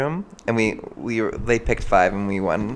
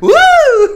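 high, loud startled whoop; startled shriek; 13 dB louder than usual. Retold or imitated. Retelling the Karen in the freezer, startled shriek. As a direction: shriek as if something jumped out. Retold scare on opening freezer.

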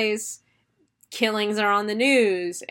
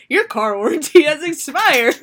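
higher, louder robocall pitch; telemarketer pitch; 5 semitones higher, 5 dB louder than usual. Pretend. Telemarketer calls the final girl, robocall pitch. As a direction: read it like a robocall. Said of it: "She picks up".

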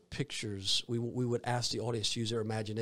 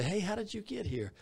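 cheerful greeting; 11 semitones higher than usual. Retold or imitated. Voicing heaven meeting.

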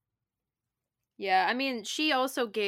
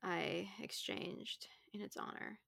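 whisper; 13 dB quieter, slower than usual. Real, not acted. Trailing aside.

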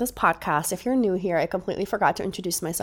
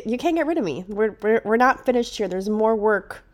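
normal talking voice

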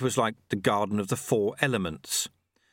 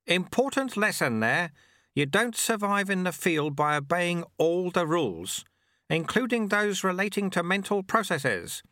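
put-on breezy, higher-pitched character voice for the old monk; high-pitched old sweeper; 8 semitones higher than usual. A narrator's reading. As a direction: read it out lightly, pitched up.

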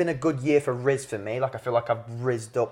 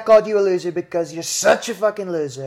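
Trump impression; 4 semitones higher than usual. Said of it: "I'm Donald Trump".

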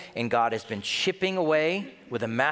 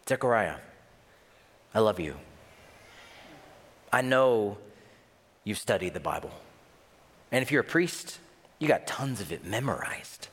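a lower, firm and tender voice of God; God speaking tenderly to Zechariah, lower and firm; 5 semitones lower than usual. Acted. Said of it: "I always imagine God's voice is deep and compassionate and firm and tender".

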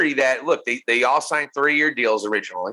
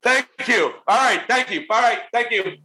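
higher, curt repeated thank-yous; dismissive brush-off; 7 semitones higher than usual. Retold or imitated. Mocking a boss who dismisses a worker with curt high thanks. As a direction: wave them away with each thank you. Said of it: "the most dismissive thing".

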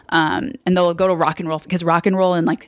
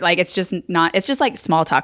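normal talking voice

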